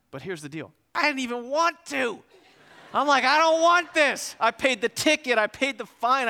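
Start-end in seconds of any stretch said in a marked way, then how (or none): 0.9-2.2 high-pitched indignant outburst; indignant protest. Retold or imitated. Comic protest at paying for friend. 3.2-6.3 high, loud aggrieved protest; indignant yell. Retold or imitated. Comic protest about unfair ticket.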